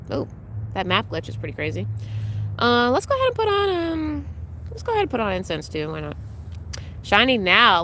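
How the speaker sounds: ordinary delivery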